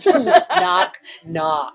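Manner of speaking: laughing. Retold or imitated. Joke voiced with laugh.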